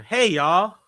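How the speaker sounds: put-on Southern-style American greeting; playful mock American accent. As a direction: try out an American "y'all". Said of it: "whenever I do an American accent".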